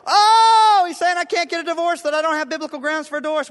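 hammed-up wail of protest; wailing, fast and high; mock wailing complaint.

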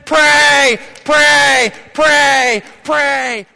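loud, high shouted prays. Acted. Preacher commanding the church to pray, shouting. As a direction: hammer each pray like a drumbeat.

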